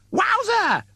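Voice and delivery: high squeaky excited yelp; a shrill-pitched cartoonish wowzer; Gwent's yell. Captain Gwent yelping at the lurching ship, very high. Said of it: "Gwent yells".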